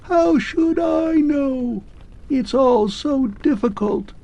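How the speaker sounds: calling from far away; distant thin voice. Acted. Vanishing clockwork man calls back to Arthur, distant thin voice. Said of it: "sounded like someone shouting in the distance".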